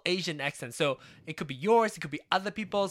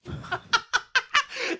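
high laugh; 4 dB louder than usual. Real, not acted. Laughing after the impression.

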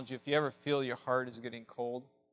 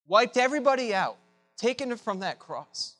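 high, loud rising preacher's build; preaching at full force; 9 semitones higher, 9 dB louder than usual. Acted. Preacher stirs the church over Jesus' shame, full force. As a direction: pound out each word of the shame.

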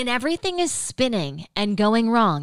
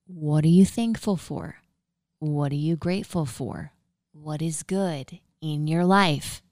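usual speaking voice

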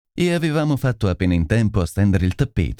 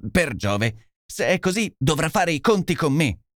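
a mild raised outburst from Lestrade; a touch of an indignant shout, pitched up; 6 semitones higher than usual. Acted. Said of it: "gridò Lestrade". (esclamazione indignata, appena accennata)

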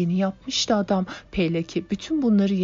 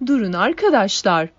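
shouting; 3 semitones higher, 7 dB louder than usual. A narrator's reading. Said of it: "diye bağırdı Natasha".

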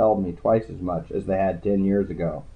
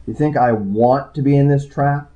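sharp, raised angry retort; snapping angrily; 5 semitones higher, 5 dB louder than usual. A narrator's reading. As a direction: snap back, high and loud. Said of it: "I snapped at him".

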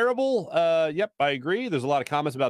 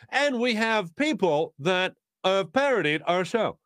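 slow, measured TV host impression; Chris Hansen. TV host introducing parodies of his show, slow presenter impression. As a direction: announce it deliberately like a show host. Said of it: "that's my Chris Hansen impression".

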